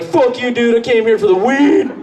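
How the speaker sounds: an entitled newcomer snapping at a local; mock stoner bro. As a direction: play the rude newcomer bro. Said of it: "I come back and was like".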